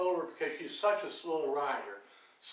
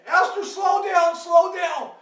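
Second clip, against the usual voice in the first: high, loud warning shout; retold scream; 12 semitones higher, 12 dB louder, slower than usual. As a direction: scream it out to stop a runaway rider, acting it out. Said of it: "So I scream".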